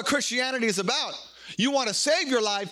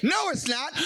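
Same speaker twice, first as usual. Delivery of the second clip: put-on a child's protest, pitched higher and shouted; whiny kid; 6 semitones higher than usual. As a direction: protest like a stubborn little kid. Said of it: "and the kid's, like".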